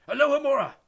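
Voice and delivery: loud high barked spell; rough shouted spell. A narrator's reading. Death Eater forcing the door open, rough shout. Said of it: "said a rough voice".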